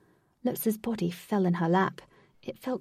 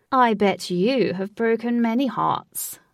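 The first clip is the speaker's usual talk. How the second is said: louder, slow theatrical villain; booming Lutz; 3 semitones higher, 5 dB louder, slower than usual. A narrator's reading. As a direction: project it like a showman over music. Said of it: "sounded like he was using a megaphone".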